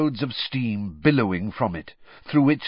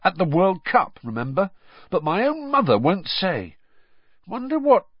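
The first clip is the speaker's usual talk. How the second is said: higher-pitched aggrieved complaint; put-out, griping tone; grumpy; 9 semitones higher, 3 dB louder than usual. A narrator's reading. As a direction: complain with rising indignation. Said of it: "he said grumpily".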